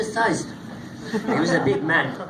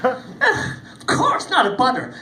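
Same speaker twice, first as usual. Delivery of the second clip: cheery warrior; 6 semitones higher, 4 dB louder, much slower than usual. Acted.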